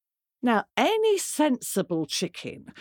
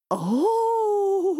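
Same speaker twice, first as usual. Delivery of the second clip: giggling duck; 12 semitones higher, 4 dB louder than usual. Acted. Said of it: "said Ducky Lucky".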